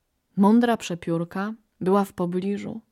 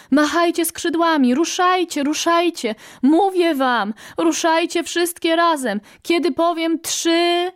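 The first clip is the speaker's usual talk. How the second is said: high-pitched urgent commands; shouting over din; 8 semitones higher than usual. A narrator's reading. First quail ordering all to move together, calling over the din. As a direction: keep pitch up and drive the flock on. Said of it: "przekrzykując rosnącą wrzawę".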